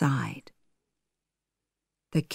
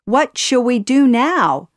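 high, loud worried parrot's outcry; raised voice, urgent question; crying out; 6 semitones higher, 5 dB louder than usual. A narrator's reading. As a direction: cry out in a mild panic. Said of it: "Iago cried".

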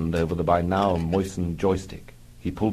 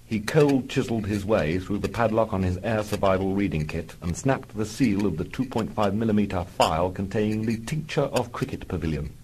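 normal talking voice

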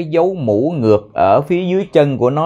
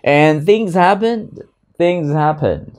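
slow, higher "things happen"; slow model read; 5 semitones higher, slower than usual. Shown as a demonstration. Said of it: "Và chuyện xảy ra thôi". (đọc chậm và cao "things happen")

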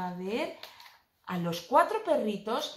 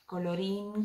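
plain reading voice

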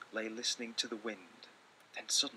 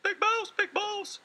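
much higher, louder, slow little voice; calling little mouse; 13 dB louder, slower than usual. Acted. Little Mouse calling his brother at night, high squeaky call. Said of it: "he called".

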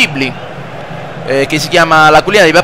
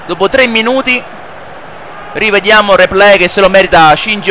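live play-by-play; 3 semitones higher than usual. Acted. Plays football video game commentating.

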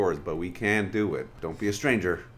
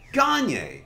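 mock French announcer; 11 semitones higher, 7 dB louder than usual.

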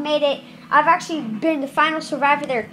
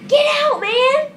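much higher, louder shout at an intruder; frustrated yell; 10 semitones higher, 4 dB louder than usual. Real, not acted. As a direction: chase him off out loud. Yelling at infected player.

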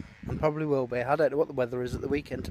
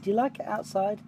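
gentle coo, a bit higher; 5 semitones higher than usual. Real, not acted. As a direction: ask the pet sweetly. Cooing at Chloe.

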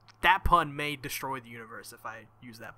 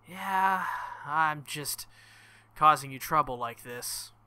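ordinary talk, nothing marked

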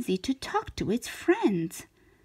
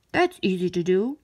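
quick, lower, brisk magical stranger; lightly voiced shapeshifter, quick and lower; 4 semitones lower, faster than usual. A narrator's reading. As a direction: rattle it off like a cheerful conjurer. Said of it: "said the shapeshifter".

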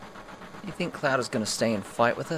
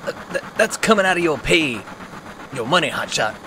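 blustering commands; flustered then commanding; gruff loud orders; 3 semitones higher, 5 dB louder than usual. Acted. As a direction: bark out the orders. Barret covering embarrassment, orders.

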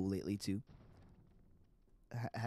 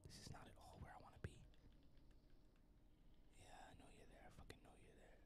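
unvoiced whisper while sneaking past enemies; hushed, keep it under your breath; 2 semitones lower, 10 dB quieter than usual. Real, not acted. Gamer whispering so the headless won't hear. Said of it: "I don't know why I'm whispering".

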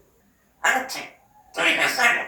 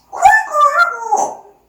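high sing-song mimic; 13 semitones higher, 6 dB louder than usual. Shown as a demonstration. Mimicking a sound for the bird.

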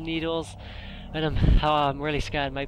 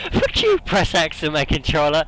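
panicked angry yell; 8 dB louder than usual. Real, not acted. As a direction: yell back at a friend mid-panic. Angry at friend's useless advice.

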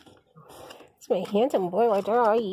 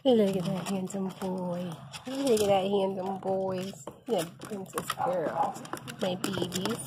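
soft cooing; 5 semitones lower, 6 dB quieter than usual. Real, not acted. Cooing at the cats.

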